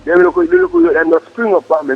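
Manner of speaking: ordinary delivery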